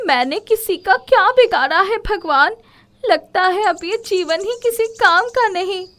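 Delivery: high, loud tearful plea to God; crying plea. Acted. Komal weeping under the tree, high tearful lament. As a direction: cry out to God in despair. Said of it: "कोमल रोते हुए".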